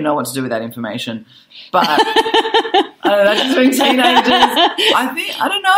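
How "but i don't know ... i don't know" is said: laughing. Real, not acted. Laughing at teens' contradictory feedback.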